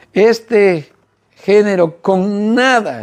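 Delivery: "nada" stretched out slowly for emphasis; slow the phrase down and lean on "nada"; stress on "nada". Shown as a demonstration. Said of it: "escucha la palabra nada".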